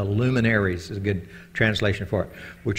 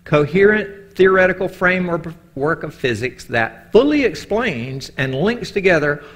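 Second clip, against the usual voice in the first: higher, louder, slow formal reading; reading a definition; 6 semitones higher, 5 dB louder than usual. Shown as a demonstration. Preacher reading the physics definition aloud, lightly formal. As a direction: read the definition out with weight. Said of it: "this is a definition".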